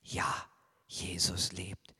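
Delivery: hushed whisper. Acted. Preacher whispers the Easter news to children. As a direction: breathe it like a secret.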